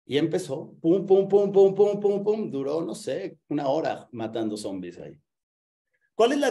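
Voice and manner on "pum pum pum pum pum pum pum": loud, high "pum" shot noises; punchy mouth-made bangs, evenly repeated; imitated gunfire. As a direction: mimic a forceful volley of pistol booms. Imitates nephew firing away.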